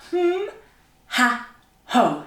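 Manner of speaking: high hum, then punched ha and ho; diaphragm exercise sounds. Shown as a demonstration. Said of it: "get our diaphragm working".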